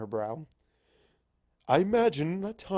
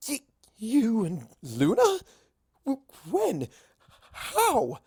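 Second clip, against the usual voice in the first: much higher, sputtering disbelief; stammering shock; 8 semitones higher, 3 dB louder, slower than usual. Acted. Rarity sputters at news of Star Swirl and Luna, shocked. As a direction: gasp out the questions.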